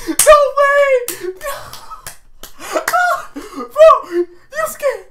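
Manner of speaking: high, loud laughing disbelief; laughing hysterically. Real, not acted. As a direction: laugh through the words. Hysterical reaction to the scene.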